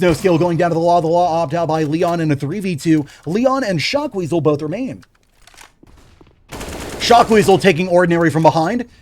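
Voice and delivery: energetic esports caster voice; play-by-play commentary. Acted. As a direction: call the round like a live caster. Casting live esports match.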